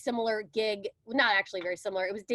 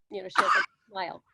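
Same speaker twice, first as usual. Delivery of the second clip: ordinary talk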